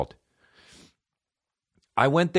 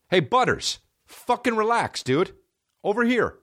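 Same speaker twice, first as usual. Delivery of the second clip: calling the dog; 11 semitones higher than usual. Real, not acted. Host calling his dog Butters to come over, raised voice. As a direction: call the dog over, half-scolding.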